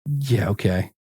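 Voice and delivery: low, dry, unconvinced yeah okay; sarcastic. Retold or imitated. Brushing off a promise of easy podcast money, sarcastic. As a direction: roll your eyes and say it flat and low. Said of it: "And I'm like".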